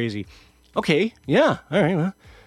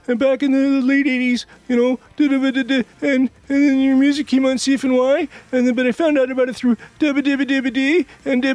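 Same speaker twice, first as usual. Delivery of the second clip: much higher and louder, gushing starstruck babble; babbling fan; 14 semitones higher, 5 dB louder than usual. Retold or imitated. Starstruck fan gushing at [name], high and loud. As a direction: spill it all out like an awkward superfan. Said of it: "it was just verbal diarrhea coming out of my mouth".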